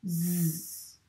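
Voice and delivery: Z as a buzzing zzz; Z sound. Shown as a demonstration. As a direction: hold the buzz on Z. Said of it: "et on l'a prononcé". (le son Z bien appuyé)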